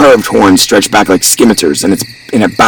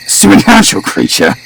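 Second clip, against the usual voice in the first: high mock-spooked question; joking; 11 semitones higher than usual. A narrator's reading. Philip teases about what killed the sheep, high joking voice. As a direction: pitch it up in jest. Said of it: "Philip joked".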